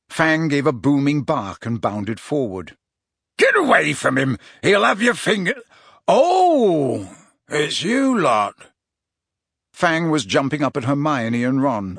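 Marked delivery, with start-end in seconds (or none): 3.4-5.6 shouting. A narrator's reading. Hagrid yells warning. 6.1-8.7 gruff. A narrator's reading. Hagrid recognizes them flatly.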